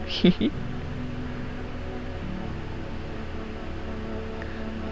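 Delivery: soft acted titter; giggle. Visitor settling into a stranger's house, giggling. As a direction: giggle quietly to yourself.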